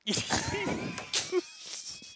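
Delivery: high, breathless laughter; laughing. Real, not acted. Co-host joking about asking Australians directions, high laughter.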